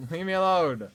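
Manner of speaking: real fed-up shout after a laugh; exasperated yell. Real, not acted. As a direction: yell at the enemies hounding you. Yells at enemies in game.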